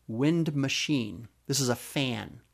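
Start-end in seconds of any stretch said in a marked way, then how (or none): 0.1-1.3 slow reveal. Shown as a demonstration. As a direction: Wind machine, drawn out slowly. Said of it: "think about the sound".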